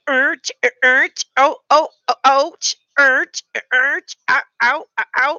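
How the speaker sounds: high strained grunting and ouches; grunts and ows. Acted. Grunting ouches while doing the butt dance for the stream. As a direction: yelp it out in a comic strain.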